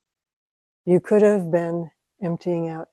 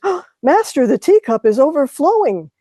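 eager student; 7 semitones higher, 7 dB louder than usual. Retold or imitated. Student addresses Zen master.

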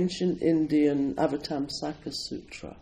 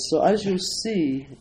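usual speaking voice

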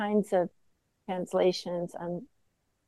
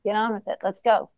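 ordinary talk, nothing marked

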